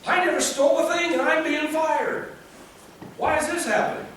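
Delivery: high, aggrieved pleading; lightly voiced wounded servant; David's complaint. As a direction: protest to the Lord like a wronged worker. Voicing David's complaint to God.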